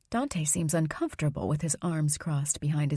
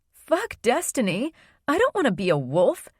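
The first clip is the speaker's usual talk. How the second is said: loud, tearful outburst at grandma; angry shout; 4 semitones higher, 3 dB louder than usual. Acted. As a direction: yell it in betrayed anger.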